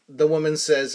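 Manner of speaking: ordinary delivery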